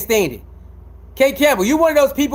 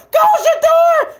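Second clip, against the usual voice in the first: mock fan cheer; 4 dB louder than usual. Mocking a hater turned cheering fan, screamed high. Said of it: "Screaming loud as hell".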